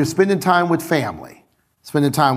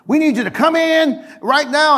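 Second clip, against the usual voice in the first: raised, higher demand mimicking a pushy boss; mock loud boss; 10 semitones higher than usual. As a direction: imitate the company yelling down the phone. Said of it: "they were loud on the phone".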